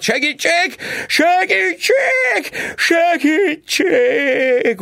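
high, drawn-out scream for help; cry for help. A narrator's reading. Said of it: "elkiáltotta magát". (magas, elnyújtott segélykiáltás)

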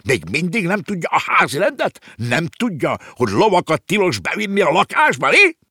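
high, loud outraged tirade; shrill scolding at the top of the voice; indignant screeching. A narrator's reading. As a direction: screech orders like an outraged landlord. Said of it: "rikálcsolta felháborodottan".

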